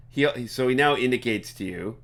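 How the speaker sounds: ordinary delivery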